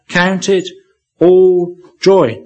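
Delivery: pause, then "all" punched loud and high; stress on ALL. Shown as a demonstration. Preacher repeating James's words, emphasizing "all". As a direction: hit "all" hard after a short break. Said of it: "Emphasize that word".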